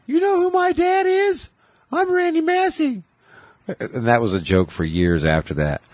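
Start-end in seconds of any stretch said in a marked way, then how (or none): 0.1-3.0 put-on very high, squeaky boy's voice; Randy's high voice. Randy bragging about his dad, squeaky high boy voice. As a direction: brag like a kid whose voice hasn't dropped. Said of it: "He had a high voice when we were kids".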